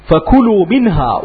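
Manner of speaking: ordinary talk, nothing marked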